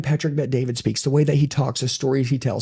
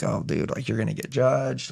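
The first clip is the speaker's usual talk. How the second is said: hushed, lower inner voice; hushed aside; 3 semitones lower, 6 dB quieter than usual. Retold or imitated. In church, hushed inner thought about judgment. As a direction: mutter it under your breath in church.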